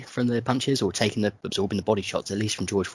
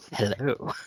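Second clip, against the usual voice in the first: sexual radio voice; 4 dB quieter than usual. Shown as a demonstration. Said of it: "I describe it sexual".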